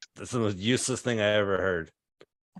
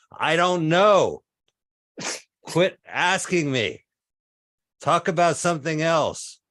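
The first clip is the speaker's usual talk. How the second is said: loud, higher, drawn-out exasperation; mock-irritated, raised-voice retort; exasperated outburst; 6 semitones higher, 4 dB louder, slower than usual. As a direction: act fed up, stretching the words in protest. Comic acted irritated retort on entering room.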